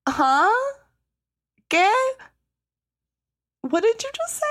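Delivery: shrill, high double-take; stunned disbelief. Retold or imitated. Reacting to the cop refusing the grieving father, high shocked disbelief. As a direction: react like you can't believe what you just heard. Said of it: "I was like".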